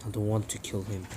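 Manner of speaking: low, soft, cold threat; Nagato's voice. Retold or imitated. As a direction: menace quietly from deep down. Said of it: "Nagato's like".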